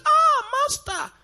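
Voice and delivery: high, startled cry of recognition; Mary's awed reply. Acted. Mary, recognising Jesus, cries out to him with a high gasp. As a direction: gasp it out like Mary seeing her Lord. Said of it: "You say".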